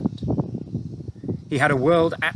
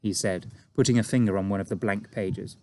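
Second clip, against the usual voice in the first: usual speaking voice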